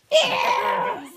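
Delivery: high laughing yell; laughing shout. Real, not acted. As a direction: laugh it out loud.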